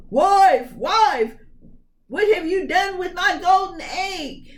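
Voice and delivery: high, loud furious roar. Acted. Ogre roaring at his wife over the stolen egg. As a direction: bellow for the wife in a rage. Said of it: "he heard him roaring".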